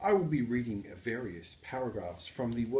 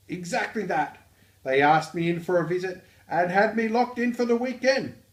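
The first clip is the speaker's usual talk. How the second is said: loud, high-pitched Australian; Australian accent; 8 semitones higher, 11 dB louder than usual. Acted. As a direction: belt it out in a broad, hammed-up Aussie accent. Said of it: "the work known as the Australian accent".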